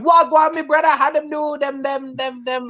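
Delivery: high, rapid-fire mock Jamaican patois. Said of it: "Jamaican music with the patois there".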